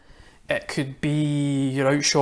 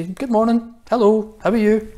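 higher, bright hello in the town centre; cheery greeting; 6 semitones higher than usual. Retold or imitated. Pastor retelling his hellos in Port Glasgow Town Centre. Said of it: "I walk around ... and just say".

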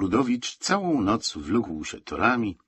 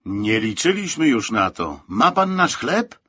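raised, suspicious asking; loud, mistrustful query; distrustful question; 4 semitones higher, 7 dB louder, slower than usual. A narrator's reading. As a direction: end high on a wary question. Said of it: "spytał nieufnie Kozłowski".

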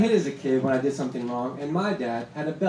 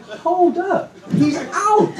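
much higher, loud indignant call; protesting call; 12 semitones higher, 6 dB louder, much slower than usual. Retold or imitated. Fan protesting the batter who stays at the plate, high loud yell. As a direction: yell it like the ump missed it. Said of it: "And I'm like".

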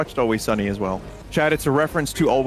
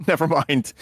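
laughing; 4 semitones higher than usual. Real, not acted. Laughing at own contradiction.

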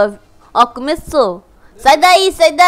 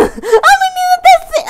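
excited shout; 14 semitones higher, 4 dB louder than usual. Real, not acted. Kid shouting about game character.

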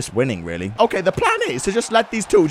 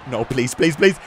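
ordinary talk, nothing marked

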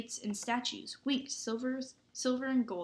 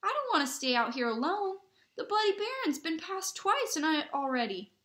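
high, louder anxious pleading; 7 semitones higher, 5 dB louder than usual. A narrator's reading. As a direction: plead in rising panic. Scared boy begs to come along.

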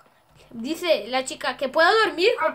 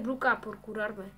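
ordinary talk

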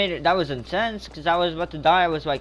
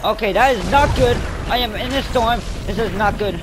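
higher, louder panicked rush; panicking in the storm; 5 semitones higher, 5 dB louder than usual. Real, not acted. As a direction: sound trapped and scared.

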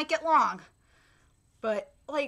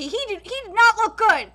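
ordinary delivery

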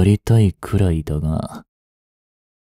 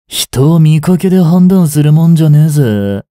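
loud and raised, lecturing the doctor; angry scolding; 6 semitones higher, 6 dB louder than usual. Acted. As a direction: put him in his place, loudly. Scolds doctor who doubts him.

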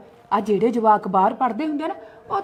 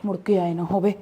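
much lower and softer, under the breath; muttered aside; 5 semitones lower, 6 dB quieter than usual. Acted. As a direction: grumble to yourself so she can't hear. (ਹੌਲੀ ਆਵਾਜ਼ ਵਿੱਚ ਆਪਣੇ ਆਪ ਨਾਲ ਬੁੜਬੁੜ)

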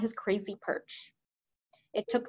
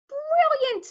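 very high, louder exclamation; delighted; 6 dB louder than usual. Acted. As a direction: burst out in admiration. Queen delighted by the plan.

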